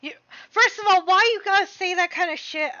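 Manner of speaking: outraged rant. Real, not acted. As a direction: scold the character. Outraged at game dialogue.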